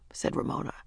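ordinary delivery, nothing marked

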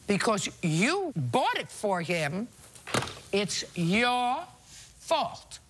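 drawn-out words with pauses before the last; exasperated, deliberate scolding; slow, emphatic blame. Real, not acted. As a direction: drive it home slowly, word by word. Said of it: "I can't say it any slower".

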